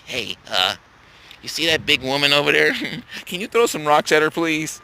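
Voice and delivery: low, hushed mock voice of a little man; mocking a timid lackey. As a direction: play a sheepish underling asking a favour. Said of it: "a little motherfucker standing there".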